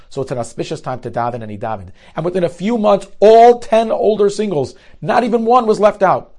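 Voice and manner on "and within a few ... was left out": swelling higher and louder, slowing on all ten singles; preacher's triumphant crescendo; rising excitement. Real, not acted. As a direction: build to a triumphant cry as you announce the miracle. Climax of the miracle story.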